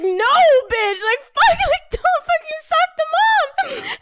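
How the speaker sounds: high, loud, half-laughing retold protest; exasperated comic shriek; alarmed telling-off. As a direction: squeal at a friend to stop. Retold scolding of reckless friend.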